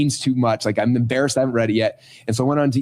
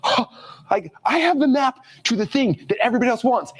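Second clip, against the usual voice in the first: amazed realization; 9 semitones higher than usual. Retold or imitated. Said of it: "it's like".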